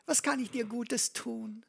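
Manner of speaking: zärtlicher Vater, deutlich höher gesprochen; besorgter Vater. Retold or imitated. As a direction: wie ein übertrieben besorgter Papa, höher und sanfter. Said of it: "da hat er ihn gefragt". (gespielt liebevoller Vater, höher)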